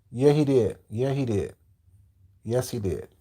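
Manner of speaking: fading repetition. Real, not acted. Confirming to chat, fading away. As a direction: let it trail off into a mutter.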